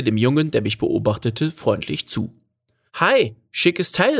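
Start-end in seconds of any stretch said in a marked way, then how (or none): 2.9-4.2 put-on high, slow, admiring boy's call. A narrator's reading. Boy calling to the camper's driver, high admiring shout-out. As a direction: call it out in a high, boyish lilt. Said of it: "rief er".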